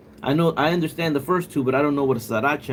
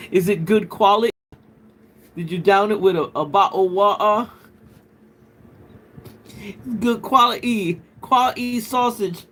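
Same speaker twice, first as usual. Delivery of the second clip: put-on high-pitched playful British accent; mock British accent; 6 semitones higher, 3 dB louder than usual. Streamer joking around with a UK viewer, mock British accent. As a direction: ham up British glottal stops, pitched up. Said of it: "that accent".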